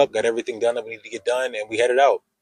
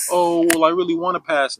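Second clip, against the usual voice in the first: mock whiny plea; 7 semitones higher, 3 dB louder than usual. Mocking whiny failing candidate.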